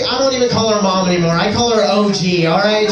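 usual speaking voice

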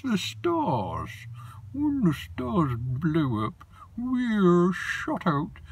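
high, slow creature voice; high and drawn out like a tiny gold creature; Snoswatch creature voice. Acted. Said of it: "And he talked like this".

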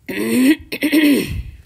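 loud, croaky frog throat-clear; high, noisy "ahem". A narrator's reading. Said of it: "The frog cleared his throat".

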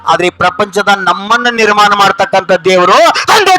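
high, sustained declaiming; impassioned preaching. Real, not acted. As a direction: drive each phrase home to the congregation. Preaches on man-made gods.

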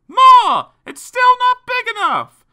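put-on very high, loud kids' yell; full high-pitched acted yell; children shouting. A narrator's reading. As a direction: shout demands like bratty children. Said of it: "they shouted".

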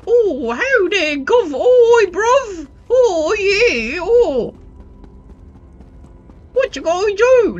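high, loud mock Cockney slang; mock British accent. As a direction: ham up a London street accent. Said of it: "changed the elf accents to be British".

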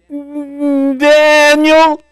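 high, drawn-out mournful call of a name; lamentable cry. Retold or imitated. The worried king calls down to Daniel, mournful high wail. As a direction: wail the name toward the den in grief. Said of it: "cried out in a lamentable voice".

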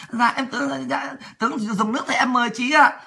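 softly voiced deferential officer; imitating polite deference. Junior officer offering the woman water, mock-polite and soft. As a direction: mimic a courteous, halting offer, kept quiet. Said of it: "Lịch sự lắm".

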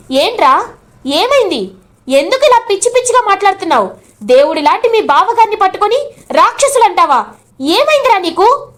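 high, heated questions, rushing in the middle; shocked scolding. Acted. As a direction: demand to know what got into him. Sister scolds her brother, shocked.

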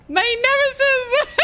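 high, slow mock-villain declaration; mock dramatic. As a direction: declare it like a melodrama.

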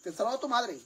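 high, stern mom warning; scolding mother. Acted. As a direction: warn the kid, your mother is talking. Mother scolds ignoring son.

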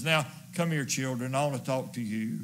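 put-on soft, low, gentle call to the kids; father calling frightened children in close, soft and low; tender father. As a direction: gather the little ones close, hushed.

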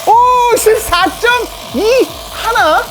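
slow, high, amazed; drawn-out surprise. Real, not acted. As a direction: stretch it out in disbelief. Reading speed result.

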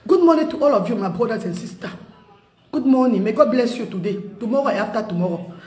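low, soft, sincere blessing. Real, not acted. Blessing her brothers and sisters, low and soft. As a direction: bless them gently.

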